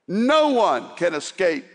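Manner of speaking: normal talking voice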